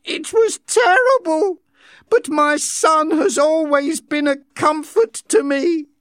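high, loud, tearful. Acted. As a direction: speak through lingering tears. Linda still upset.

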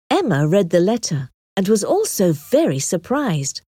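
ordinary delivery

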